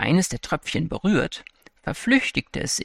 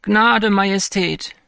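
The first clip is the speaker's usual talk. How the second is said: high, slow, louder plea for mercy; pleading cry; 6 semitones higher, 4 dB louder, slower than usual. A narrator's reading. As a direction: beg for your life. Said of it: "riefen die Unglücklichen".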